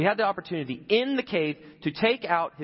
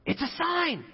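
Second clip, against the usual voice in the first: squeaky high mock gasp of discovery; mock superstitious exclaim; 13 semitones higher, slower than usual. Preacher mocking a believer spotting signs, high mock voice. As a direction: pretend you just saw an omen. Mock reaction to a moving truck.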